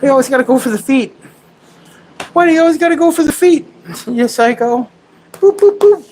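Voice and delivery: squeaky-high mock whining at the dog; playful pet scolding. Playfully scolds dog attacking feet.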